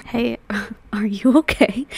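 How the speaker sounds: mock whisper. Teasing the out-of-breath woman in clip.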